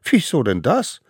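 high, loud puzzled question; dumbfounded. A narrator's reading. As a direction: ask in bewildered protest. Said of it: "waren die kleinen Wilden plötzlich baff". (laut und völlig verdutzt)

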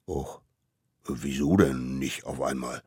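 low, soft grumble; a hint of a let-down beast; disappointed mammoth. Mammoth is kept waiting, low and soft.

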